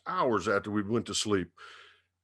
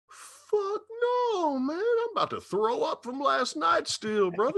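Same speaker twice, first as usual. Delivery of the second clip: pitched way up, pleading and queasy; high whiny refusal. Retold or imitated. Hungover roadie begging off the morning beer, high whine. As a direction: squeak out a hungover no to the offered shot.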